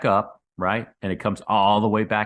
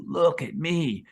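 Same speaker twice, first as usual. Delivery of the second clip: higher, hammed-up bragging; mock show-off; 8 semitones higher, slower than usual. Imitating a guru who wants attention, mock bragging. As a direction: lightly show off like a Twitter guru. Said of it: "and go".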